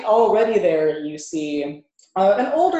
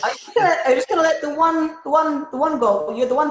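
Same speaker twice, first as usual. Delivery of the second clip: goblin voice; 10 semitones higher, 3 dB louder, faster than usual. Acted.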